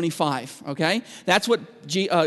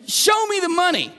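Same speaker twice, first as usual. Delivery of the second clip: mocking demand; 11 semitones higher than usual. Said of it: "Kinda like, you know".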